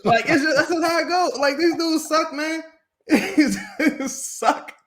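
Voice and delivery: high-pitched, helpless laughing speech; laughing through words. Real, not acted. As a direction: talk while cracking up. Cracking up after his impression.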